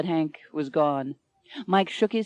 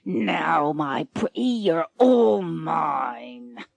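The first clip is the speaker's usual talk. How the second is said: leering pirate; 3 semitones lower, much slower than usual. Acted. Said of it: "He leers at her".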